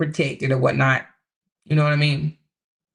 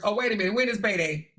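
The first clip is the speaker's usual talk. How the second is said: usual speaking voice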